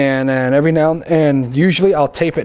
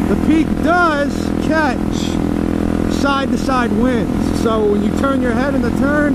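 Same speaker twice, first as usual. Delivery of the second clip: muffled through a helmet mic over wind; helmet mic while riding; 2 semitones lower than usual. Real, not acted. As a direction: talk from inside a helmet on the road.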